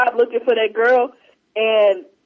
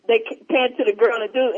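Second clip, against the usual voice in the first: normal talking voice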